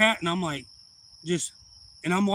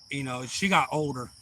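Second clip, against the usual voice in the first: ordinary delivery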